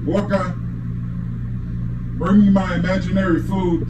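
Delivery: normal talking voice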